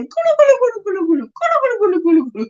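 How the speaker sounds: high, quick gobbling gulu gulu; high-pitched mock turkey chatter; turkey gobble imitation. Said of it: "That's what it sounds like".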